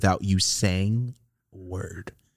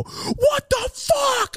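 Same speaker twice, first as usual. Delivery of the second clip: high drawn-out shocked cry; shocked yell; much slower than usual. Retold or imitated. Friend shocked by the spit trick, crying out high and slow. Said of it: "and they go".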